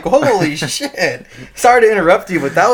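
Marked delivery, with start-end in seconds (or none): 0.0-1.3 laughing disbelief. Retold or imitated. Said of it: "I'm like".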